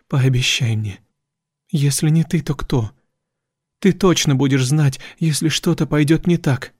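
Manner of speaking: ordinary delivery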